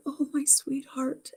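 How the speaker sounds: father greeting his lost love, soft and slow; tender endearment. Retold or imitated. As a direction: breathe it out like a lover's hello. Father tenderly greets her on phone.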